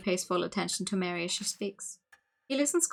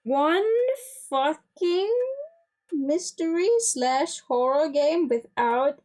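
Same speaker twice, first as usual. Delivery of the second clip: higher, louder, slow exasperated rant; exasperated outburst; 8 semitones higher, 5 dB louder, much slower than usual. Real, not acted. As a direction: vent at the game, drawn out. Frustrated outburst at the game.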